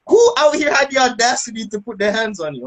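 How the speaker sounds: high-pitched indignant demand; mock fury on a high pitch; outraged wife. Imitating outraged Jamaican wife.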